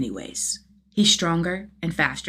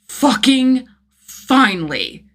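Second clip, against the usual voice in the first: louder, higher, slow and stretched; slow furious yell of triumph; triumphant shout; 7 semitones higher, 7 dB louder, much slower than usual. Acted. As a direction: explode with relief and anger. Jason finally breaks in.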